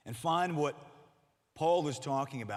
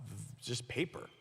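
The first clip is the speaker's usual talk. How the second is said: ordinary talk, nothing marked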